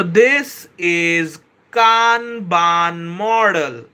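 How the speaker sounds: deliberately slow, spaced-out explaining; slowed-down explanation. Shown as a demonstration. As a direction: stretch every phrase out for the chat. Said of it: "I'm gonna slow down".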